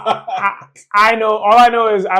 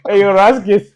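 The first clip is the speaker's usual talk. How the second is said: laughing; 3 semitones higher, 6 dB louder than usual. Retold or imitated. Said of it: "laughs while quoting".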